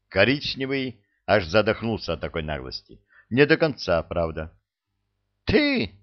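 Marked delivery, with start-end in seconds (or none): 5.4-6.0 much higher, spat with a mouthful. Acted. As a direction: spit the word out in outrage. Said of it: "выплюнул вместе с остатком булки".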